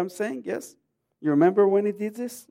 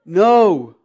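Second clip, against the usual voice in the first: emphatic no; 2 semitones higher, 12 dB louder than usual. Real, not acted. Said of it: "correcting his own joke".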